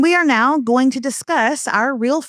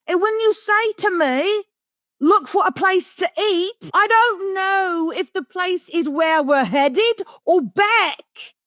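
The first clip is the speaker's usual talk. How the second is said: mock British accent, slow and rising higher as the rant goes on; 5 semitones higher, slower than usual. Host airing complaints to her husband in a put-on British accent. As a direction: pretend to be a fed-up Brit airing grievances. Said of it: "in a British accent".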